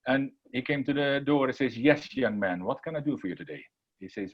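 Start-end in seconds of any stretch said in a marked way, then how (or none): 1.8-3.6 put-on low, soft wise old man. Wise man greeting young visitors at his door, low and calm. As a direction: answer gently, pitched well down.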